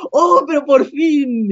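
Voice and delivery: high, slow, laughing relief; finally, said high and drawn out with a laugh; joyful relief. Retold or imitated. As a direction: stretch it out, half laughing with joy. Emotional reunion, relief.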